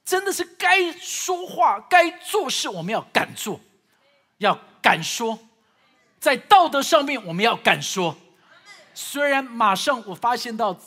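loud, impassioned pulpit delivery; fervent preaching. Acted. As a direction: preach it out with full force. Preaching at full force. (激昂讲道)

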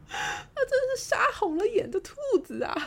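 high-pitched mock horror; amused exclamation. Real, not acted. Speaker on the friend's rampage, amused exclamation. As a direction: cry out in delighted disbelief.

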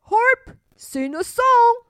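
the giant ordering his harp to sing, loud; giant commanding. Acted. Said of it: "he said".

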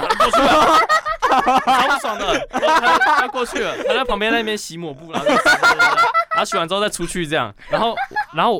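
bursts of laughter through the words; laughing while telling. Real, not acted. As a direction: laugh helplessly as you tell it. Laughing while retelling awkward moment. (边笑边说)

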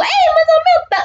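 high mock call to lost friends. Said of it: "我就大喊说".

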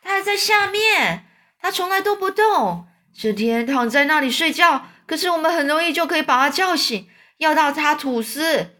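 high-pitched excited exclaiming; calling out excitedly. A narrator's reading. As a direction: cry out the discovery in a high voice. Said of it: "绿色老蚱蜢就大叫".

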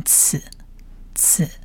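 "刺" as the sound of zayin, repeated alone; letter-sound demo. Shown as a demonstration. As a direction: give "刺" as an isolated hissed consonant sound. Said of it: "在发音上面它念刺". (示范字母发音“刺”)

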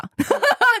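high, loud burst of laughter. Real, not acted. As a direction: crack up at the punchline. Laughing at the friend's joke.

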